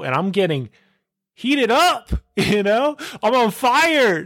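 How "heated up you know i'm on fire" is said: high, excited exclamations with drawn-out final words; fired-up hype. Acted. Podcaster declaring himself in his zone of fire, hyped up. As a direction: pump yourself up in a high, stretched cry. Hyping himself up about heat spots.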